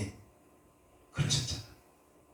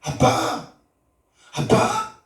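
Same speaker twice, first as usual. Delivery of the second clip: fervent calling; 5 semitones higher, much slower than usual. Retold or imitated. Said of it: "지나갈 때마다".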